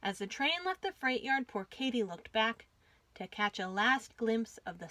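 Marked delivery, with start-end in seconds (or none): none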